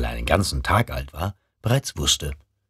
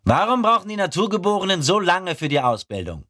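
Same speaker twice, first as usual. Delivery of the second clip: higher, eager questioning voice; curious recruit. Acted. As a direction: ask like a keen young recruit. Rekrut stellt eine Frage. (neugieriger junger Rekrut)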